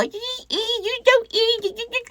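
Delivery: very high, squeaky mocking voice; mocking commenters. Imitating viewers bashing him in the comments, high squeaky mockery. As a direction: squeal it in a tiny, put-on falsetto.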